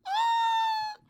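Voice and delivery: high siren wail, then repeated warning; mock robotic danger alert; mock alarm. Acts out her inner warning alarm.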